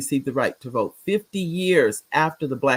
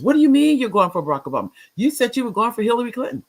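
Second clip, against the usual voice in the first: retold indignant outburst, higher and fast; quick, raised-pitch mock confrontation; upset challenge; 8 semitones higher, 4 dB louder, faster than usual. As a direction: imitate an upset coworker demanding an explanation.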